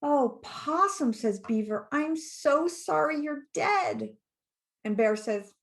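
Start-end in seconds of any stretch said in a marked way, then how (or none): 0.0-1.1 high, slow storybook lament; drawn-out, pitying high voice; mock lament. A narrator's reading. Beaver mourns supposedly dead Possum. 1.9-4.1 high, slow apology; drawn-out, high-pitched regret; mock lament. A narrator's reading. Beaver mourns supposedly dead Possum.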